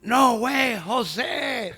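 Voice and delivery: loud angry yell, pitched high; screamed. Retold or imitated. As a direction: shout it at the waiter like a furious big customer. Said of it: "and he screamed to me".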